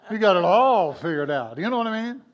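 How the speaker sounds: very loud, hammed-up certainty; mock know-it-all. Playing someone who has it all figured out, loud mock boasting. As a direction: boom it out like you have all the answers.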